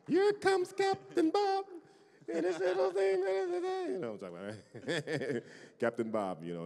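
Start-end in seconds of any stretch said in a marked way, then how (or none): 0.1-4.0 very high, slow Jamaican cartoon accent. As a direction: sing it out like Captain Bob in a Jamaican accent. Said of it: "a Jamaican accent".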